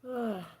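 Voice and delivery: high, falling sigh; releasing sigh; emotional sigh. Real, not acted. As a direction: sigh it out to recover from tears. Recovering from crying.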